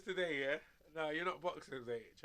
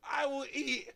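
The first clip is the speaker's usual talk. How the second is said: imitating Tyson; 6 semitones higher, 7 dB louder than usual. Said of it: "That's what he says".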